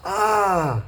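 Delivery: sudden sharp cry of pain; pained yelp. Real, not acted. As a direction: yelp as the iron burns. Just burnt his pinky.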